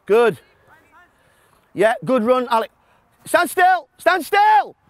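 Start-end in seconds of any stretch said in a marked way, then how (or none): 3.3-4.8 calling out. Real, not acted. Coach calling players to freeze.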